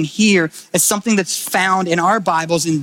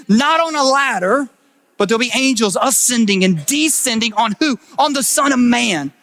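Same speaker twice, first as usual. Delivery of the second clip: higher, urgent preaching voice; rising preaching; 4 semitones higher than usual. Acted. Pastor voicing Jesus to Nathanael, higher and building. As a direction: build the revelation with fire.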